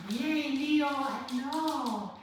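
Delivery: high, bright sing-song greeting to the dog. Real, not acted. Greeting Leo the puppy, high excited baby talk. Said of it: "so we're gonna baby talk".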